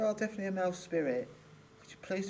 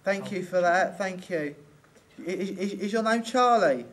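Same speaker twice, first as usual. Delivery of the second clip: calling out loud; 8 dB louder, slower than usual. Real, not acted. Calling loudly to the spirit.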